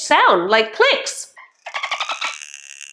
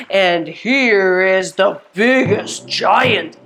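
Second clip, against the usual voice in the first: slow, deep, stretched words; big giant voice; 7 semitones lower, slower than usual. Pretend. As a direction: go low and weighty to sound enormous. Voices a giant's bigness in rhyme.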